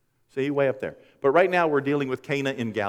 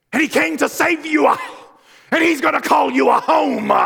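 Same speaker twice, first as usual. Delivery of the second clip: booming, much higher and louder funeral sermon; preaching at full force; 14 semitones higher, 7 dB louder than usual. Retold or imitated. Pastor preaching to mourners at a funeral, full-force shout. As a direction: shout the gospel over the casket. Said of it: "And I'm like".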